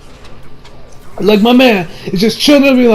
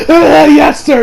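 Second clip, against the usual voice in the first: high mock-crazed laughing reply; laughing like a madman; 6 semitones higher, 3 dB louder, slower than usual. Senator obeying Dio's order, imitated madman's laughing. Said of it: "starts laughing like a madman".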